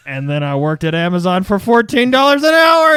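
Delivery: loud, rising mock triumph; intense testimonial. Amazon ad mail guy on his new job, mock-triumphant. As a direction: build steadily to an overblown proclamation. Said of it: "like, intense story".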